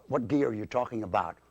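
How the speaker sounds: ordinary talk, nothing marked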